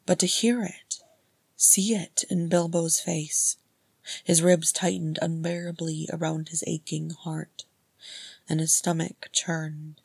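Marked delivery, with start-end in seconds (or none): none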